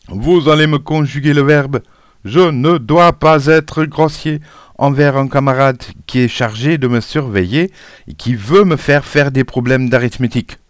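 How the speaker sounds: high, loud schoolmaster's reprimand, easing slightly; stern scolding. A narrator's reading. Supervisor punishing a rude pupil, scolding loudly.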